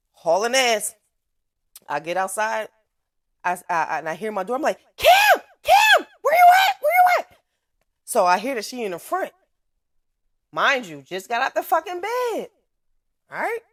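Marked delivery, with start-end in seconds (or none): 5.0-7.4 put-on high, loud shouting for a child; panicked mom calling her kid; frantic calling out. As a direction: yell her name across the yard. Mother calling for her daughter outside.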